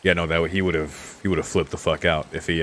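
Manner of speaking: normal talking voice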